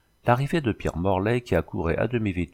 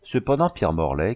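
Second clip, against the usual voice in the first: plain reading voice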